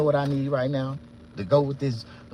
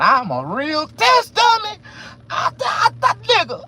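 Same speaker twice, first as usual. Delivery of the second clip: high, loud, slow outburst; high loud test dummy rant; shouting; 9 dB louder, slower than usual. Real, not acted. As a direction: yell it out indignant. Shouting about being test dummy.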